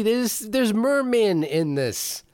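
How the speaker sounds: high-pitched, squeaky protest; excited insistence. Real, not acted. To cohost, insisting the film is the sea, excited high protest. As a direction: pipe up high to win the argument.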